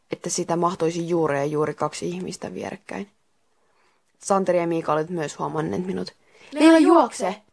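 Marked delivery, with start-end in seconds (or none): 6.5-7.4 high, loud urgent call; storyteller voicing a group's shout; shouted warning. A narrator's reading. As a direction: cry out urgently to make someone move. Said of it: "Kaikki huusivat yhteen ääneen".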